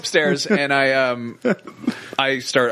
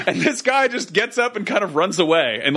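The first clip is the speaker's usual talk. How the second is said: ordinary talk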